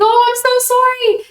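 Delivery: re-enacted apology in a very high pitch; high apologetic voice. Woman apologising to hurt little girl, high-pitched and gushing. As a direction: gush a retold sorry, high up.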